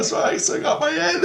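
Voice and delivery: very high, laughing astonishment; amazed relief. Retold or imitated. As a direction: act out disbelief at having survived.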